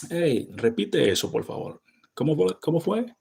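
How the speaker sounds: rising, very high "¿Cómo fue?"; Dominican casual. Shown as a demonstration. Asking a friend to repeat, lively Dominican Spanish. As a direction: ask it back with a lively lift. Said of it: "We say, like".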